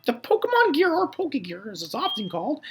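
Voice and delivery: high falsetto explainer; game mom voice. Acted. Mom explaining the Pokegear to her child, high falsetto voice. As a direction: explain it in a high, put-on motherly voice.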